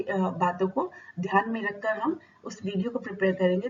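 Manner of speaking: ordinary talk, nothing marked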